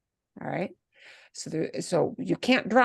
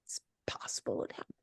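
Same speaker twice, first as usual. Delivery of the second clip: whisper; 16 dB quieter, faster than usual. Acted. Teacher muses on subjunctive maybe.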